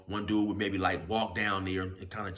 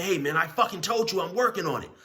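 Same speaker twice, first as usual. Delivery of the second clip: much higher, louder snap; retold angry snap, higher and louder; angry retort; 10 semitones higher, 7 dB louder than usual. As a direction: snap back at him. Big dude snaps back.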